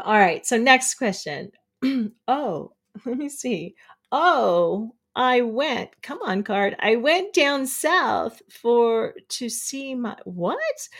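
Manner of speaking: ordinary talk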